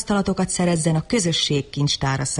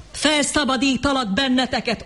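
shouted; 8 semitones higher than usual. Acted. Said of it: "ordította John".

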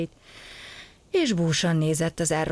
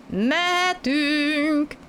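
high, very drawn-out call; calling ahead; 10 semitones higher, 3 dB louder, much slower than usual. A narrator's reading. Passenger on the buffer signals departure, calling ahead. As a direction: stretch the word out to carry forward. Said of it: "kiáltott előre".

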